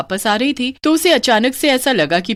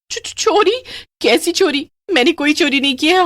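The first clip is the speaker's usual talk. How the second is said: high stammering protest; scared denial; 9 semitones higher than usual. Acted. Accused, frightened denial. (घबराई हुई सफ़ाई)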